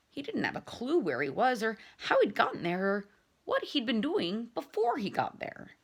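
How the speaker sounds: ordinary delivery, nothing marked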